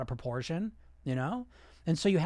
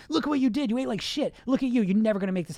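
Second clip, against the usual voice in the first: higher, louder scolding; negative inner voice; 6 semitones higher, 4 dB louder, faster than usual. Acted. Inner critic after eating badly yesterday, scolding. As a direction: nag and accuse yourself. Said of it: "that negative voice".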